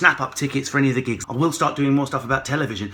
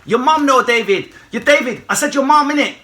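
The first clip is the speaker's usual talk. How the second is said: higher, loud chav taunt; cheeky wind-up; 10 semitones higher, 7 dB louder than usual. Pretend. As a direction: taunt like a street kid.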